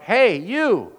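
loud call to stop a passerby; yelling out. Retold or imitated. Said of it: "yelled out".

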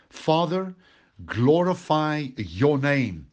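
high, slow, drawn-out plea to the Father; pleading prayer. Acted. Preacher voicing Jesus praying to the Father, slow, high and pleading. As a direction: lift it up like an anguished prayer.

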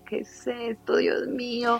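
a hushed, tearful gasp of disbelief; retold tearful dismay. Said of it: "yo obviamente me puse a llorar". (consternación llorosa, más aguda y suave)

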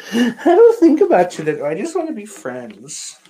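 high, loud mock voice of a girl turning him down; mock rejection. Host acts out a girl rejecting him, mocking high voice.

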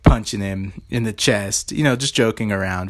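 ordinary talk, nothing marked

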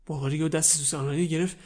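plain reading voice

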